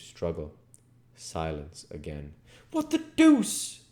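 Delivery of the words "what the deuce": slow, very high, loud exclamation. Acted. Henfrey hearing the struggle, exclaiming in shock. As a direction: blurt it out in shock. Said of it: "exclaimed Henfrey".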